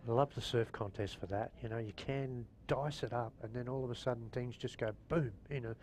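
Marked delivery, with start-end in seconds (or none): none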